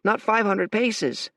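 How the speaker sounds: lightly voiced scout, tense; a hint of an anxious soldier; scout's report. Scout warns Fate the fortress is near, tense.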